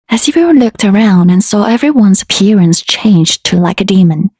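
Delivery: usual speaking voice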